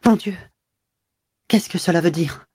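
high, stunned and frightened cry; a mother aghast at the news, voice rising; distraught. Acted. Madame Walter asking her husband, aghast and distraught. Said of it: "éperdue, atterrée".

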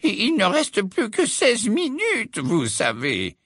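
slow, high, fretful reminder; anxious. A narrator's reading. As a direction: remind them anxiously, drawing it out. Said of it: "d'un ton anxieux".

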